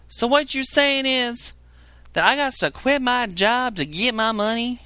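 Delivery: high mock voice of a caller misunderstanding; mocking a confused caller. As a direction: play the caller who just doesn't get it. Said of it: "the other person calls in and says".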